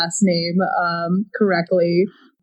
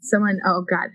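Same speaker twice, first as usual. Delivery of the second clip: ordinary delivery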